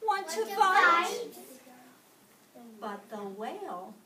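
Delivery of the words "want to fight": loud, high, picking a fight; grouchy challenge. A narrator's reading. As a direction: bark it out like a tiny bully. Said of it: "said the grouchy ladybug".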